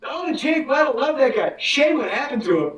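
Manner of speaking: chirpy fake praise in a high voice; high mock-admiring voice. Friend quoting colleagues, high gushing voice. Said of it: "they were like".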